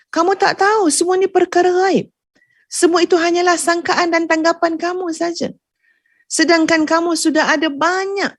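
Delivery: preacher rising to a rebuke. Real, not acted. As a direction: press the point home, pitch up.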